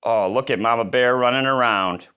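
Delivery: plain reading voice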